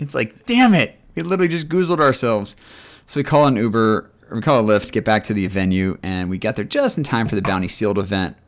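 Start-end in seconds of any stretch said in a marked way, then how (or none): 0.5-2.5 higher, louder exasperated yelp; hammed-up frustration at themselves; mock outburst. Telling cohost about the closed hookah shop, mock exasperated shout.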